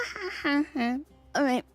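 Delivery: usual speaking voice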